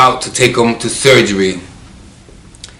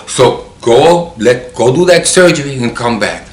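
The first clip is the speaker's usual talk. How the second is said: raised, urgent send-off; retold order; 3 semitones higher, 5 dB louder than usual. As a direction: push a friend to get going, louder. Acting out sending friend to surgery.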